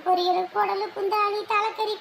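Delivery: normal talking voice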